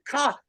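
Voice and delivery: high, soft far-off caw; a crow calling from the far mountain; imitated distant crow caw. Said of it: "up the side of the other mountain".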